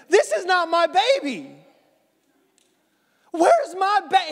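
high, loud rising panic; frantic mother. Acted. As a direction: cut off mid-word in alarm. Mother realizes baby swapped.

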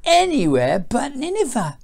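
storybook grumbler objecting loudly; groaning complaint. A narrator's reading. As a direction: protest like a reluctant prophet. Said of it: "he groaned".

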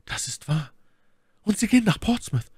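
very high falsetto lady's question; inquiring My Lady. A narrator's reading. Lady questioning her rescuer, high-pitched curiosity. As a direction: ask in a high, put-on lady voice.

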